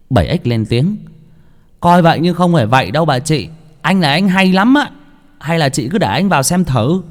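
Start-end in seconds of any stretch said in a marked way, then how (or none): 1.8-7.0 put-on higher young man's voice, persuading; eager young man. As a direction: talk her into it. Bảy Ếch character voice. (giọng thanh niên cao, thuyết phục)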